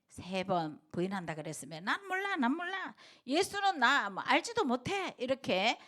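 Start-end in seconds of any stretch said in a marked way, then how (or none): 1.8-5.1 acted denial. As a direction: keep protesting you never knew him. Peter denying again. (베드로가 예수를 모른다며 높은 목소리로 부인하듯)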